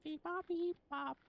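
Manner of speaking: high slow beeping robot. Pretend. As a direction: chirp it like a robot. Robot-like noises.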